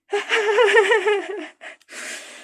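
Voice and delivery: high gleeful cackle; delighted at finding food; greedy cackle. Acted. The hungry condor spots its meal, cackling.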